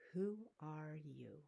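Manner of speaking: slow, searching question. Acted. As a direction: ask it slowly, drawn out. Repeated question, slower.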